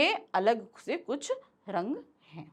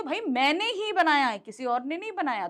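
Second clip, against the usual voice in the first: मैंने ही higher and proud; boasting about the painting, stressing मैंने; 4 semitones higher than usual. Pretend. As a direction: claim it proudly, hitting मैंने. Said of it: "मैंने शब्द पर जोर देने के लिए".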